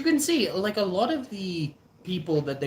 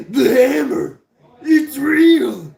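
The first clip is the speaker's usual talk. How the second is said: loud, high cartoon bro shouting; Muscle Man voice; 8 semitones higher, 10 dB louder, slower than usual. Vlogger quoting the cartoon character, loud mock bro shout. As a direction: yell it like a cocky cartoon bruiser.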